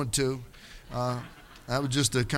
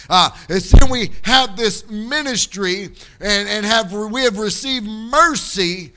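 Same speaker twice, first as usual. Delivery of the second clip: high, loud, drawn-out preacher's cadence; preaching at full force; 6 semitones higher, 9 dB louder than usual. Acted. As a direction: preach it hard to the congregation, stretching the key words. Preaching the scripture passage.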